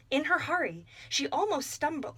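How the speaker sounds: ordinary delivery, nothing marked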